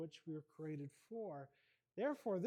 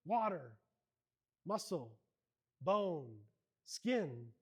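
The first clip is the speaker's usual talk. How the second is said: lightly voiced literal robot, lower; 5 semitones lower, slower than usual. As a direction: list each part flatly like a machine. Literal robot lists body parts.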